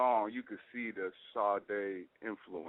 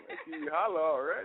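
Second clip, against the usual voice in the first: higher, laughing through the words; 6 semitones higher, 3 dB louder than usual. Real, not acted. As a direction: tease her through a laugh. Laughing while teasing the host.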